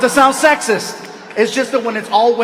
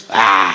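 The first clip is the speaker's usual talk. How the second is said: low, loud scornful grunt; dismissive Mexican ah; 6 dB louder than usual. Retold or imitated. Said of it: "That's not even a word".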